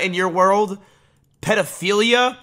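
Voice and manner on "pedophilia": ordinary talk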